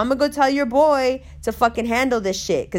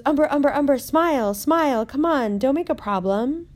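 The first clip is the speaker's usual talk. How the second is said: put-on higher pleading voice of the brown girl; placating girl; 4 semitones higher than usual. Girl at the wedding urging Umber to smile, higher coaxing. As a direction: coax her to smile and drop it, pitched up. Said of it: "and goes".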